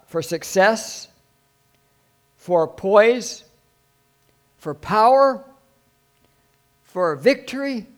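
high, loud pulpit proclamation; rousing preaching. Acted. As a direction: ring out each item, with pauses between. Preaches at full force.

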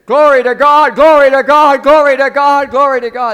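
loud, high, repeated cry of praise; imitated worship shout. Sedate believer suddenly filled with the Spirit, imitated full shout. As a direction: belt it out like an overcome worshipper. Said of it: "he started to shout".

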